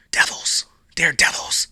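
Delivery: whispered Devils, Daredevils with a creepy hiss; spooky whisper. Pretend. Whispering the label like a spooky chant. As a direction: hiss it like a ghost story.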